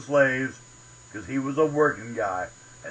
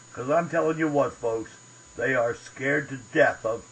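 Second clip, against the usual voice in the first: normal talking voice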